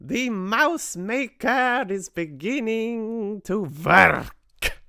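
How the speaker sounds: high, loud, slow triumphant shriek; a witch delighting in her spell working; witch's shriek. A narrator's reading. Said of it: "shrieked the Grand High Witch".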